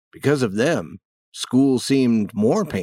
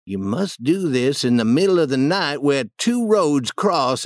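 put-on higher boy's voice giving instructions; confiding boy, Huck; 6 semitones higher than usual. Huck teaching Tom a wart charm, earnest higher voice. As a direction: explain the cure earnestly, pitched up. Huck explains bean cure.

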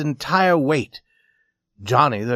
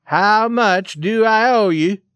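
loud, higher, slow; insistent demand; 9 semitones higher, 6 dB louder, slower than usual. A narrator's reading. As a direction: press the question hard. Swede demanding again.

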